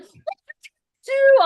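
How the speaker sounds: high, put-on voice of an alarmed husband; imitating Mike. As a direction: squeak out his panic. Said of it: "And Mike's like".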